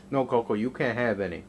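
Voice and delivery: normal talking voice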